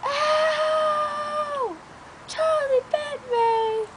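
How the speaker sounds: put-on high slow wailing child voice; crying toddler. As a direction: wail it like a bitten toddler. Quoting Charlie bit me video.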